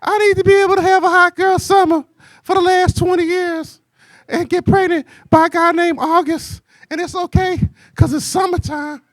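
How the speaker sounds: high mock woman's voice; mocking women's expectations in a high voice; imitating women. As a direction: pitch way up to play the women. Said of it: "they be like".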